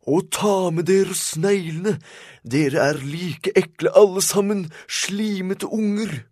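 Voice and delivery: very high, loud outraged shriek; old man screaming his head off; old man's furious scream. A narrator's reading. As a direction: scream at the vandals, stretching the key words. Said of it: "skrek så høyt at hatten".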